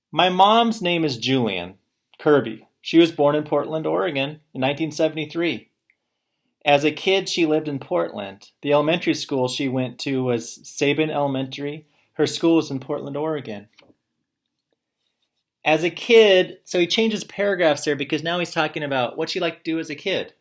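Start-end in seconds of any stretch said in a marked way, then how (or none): 0.1-13.7 loud, clear read-aloud to the class; teacher reading a student's paper aloud to the class, loud and clear. Acted. 15.6-16.6 loud, higher read-aloud. Acted. Reads student's paper aloud.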